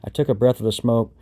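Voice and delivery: plain reading voice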